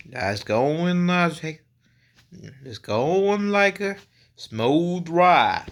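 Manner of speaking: drawn-out, badly faked country twang; bad country accent. Shown as a demonstration. Vlogger trying out an impression for viewers, bad country accent. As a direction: stretch the vowels in a poor country accent. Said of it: "Very bad impression of a country accent".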